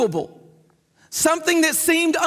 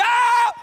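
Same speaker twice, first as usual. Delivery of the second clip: mock scream; 10 semitones higher than usual. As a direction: scream as if it really hurt. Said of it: "he screamed".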